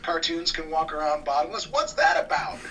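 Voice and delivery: high-pitched mock indignation; imitating an indignant protest; faux-feminist outrage. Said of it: "like, you know, faux feminist".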